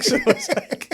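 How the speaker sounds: usual speaking voice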